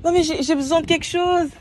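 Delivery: loud, high desperate cry; desperate outburst. Real, not acted. At the closed laundromat, a loud, high desperate plea.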